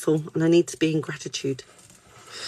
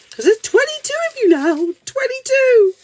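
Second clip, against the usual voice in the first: very high, loud excited squeal; excited disbelief; 6 dB louder than usual. Real, not acted. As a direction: squeal the number in amazement. Amazed at number of viewers.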